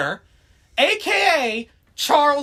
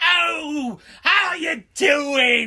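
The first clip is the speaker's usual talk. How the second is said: a lightly hammed-up cartoon British bloke; slower than usual. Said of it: "like a stereotypical British".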